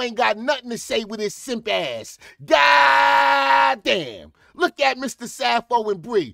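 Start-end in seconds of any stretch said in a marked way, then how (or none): 2.4-4.3 loud drawn-out goddamn yell; exasperated shout. Real, not acted. Reacting to the clip, a loud exasperated goddamn. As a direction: shout it in disbelief.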